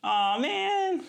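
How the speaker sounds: high-pitched, drawn-out groan of dismay. Real, not acted. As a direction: groan it like you've just been dealt bad news. Reacts to his assigned movie.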